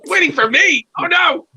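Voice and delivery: very high, loud squeal of disbelief; mock astonishment. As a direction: squeal it like an overwhelmed fan. Retelling startled reaction to receptionist.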